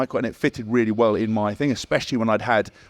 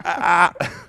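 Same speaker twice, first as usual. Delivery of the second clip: higher, hammed-up pained groans; mock groans of pain; 6 semitones higher than usual. Sore coach groaning the day after tackling, mock pained groans. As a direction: groan like your body is wrecked. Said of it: "he's like that".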